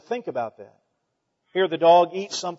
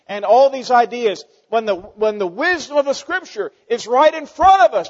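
building preacher shout; 7 semitones higher, 10 dB louder than usual. Real, not acted. Preacher rising to peak.

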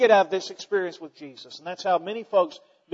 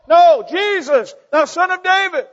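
defiant cry; 9 semitones higher, 8 dB louder than usual. Acted. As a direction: refuse to be silenced and scream for help. Beggar refuses, cries louder.

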